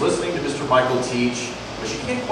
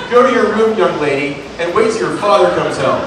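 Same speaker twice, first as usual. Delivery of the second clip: higher, louder tearful scolding; a mother yelling through tears; 5 semitones higher, 6 dB louder than usual. Acted. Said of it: "through her tears".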